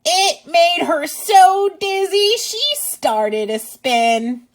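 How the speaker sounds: put-on reading to children. Teacher reading a picture book to kids, lively and loud. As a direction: read it out big for little listeners. Reads rhyming book aloud.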